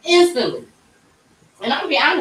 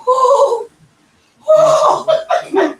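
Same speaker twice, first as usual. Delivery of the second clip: very high, loud whooping; husband seeing ultrasound picture, high joyful whoops; husband's joyful whoop; 5 dB louder than usual. Retold or imitated. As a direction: whoop like an overjoyed dad-to-be. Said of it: "He was like".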